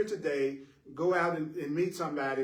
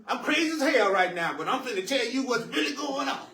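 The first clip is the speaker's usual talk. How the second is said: high-pitched hammed-up rant; mock angry rant; 6 semitones higher than usual. Upset person telling someone what's really going on, mock angry rant. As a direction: play someone crazy-mad laying down the truth.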